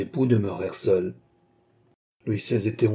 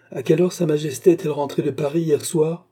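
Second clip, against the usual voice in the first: raised, high questioning call; 8 semitones higher, 5 dB louder than usual. A narrator's reading. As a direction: ask loudly through the doorway. Question called to next room.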